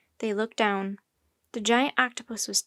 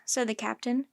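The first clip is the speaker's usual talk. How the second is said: ordinary delivery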